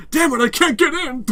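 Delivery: high, loud mock struggle; hammed-up frustration; hokey frustration. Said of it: "watching Burnham try and get through the door".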